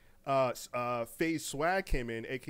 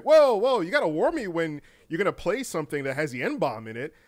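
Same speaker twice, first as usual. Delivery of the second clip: startled warning; 2 semitones higher, 8 dB louder than usual. Retold or imitated. Coworkers reacting to offensive clip.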